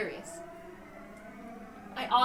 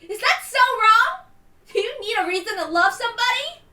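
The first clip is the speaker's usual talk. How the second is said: shouted confession, much higher and louder; defiant shouting; 8 semitones higher, 12 dB louder than usual. Acted. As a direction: throw your love in all their faces. Girl declaring love defiantly.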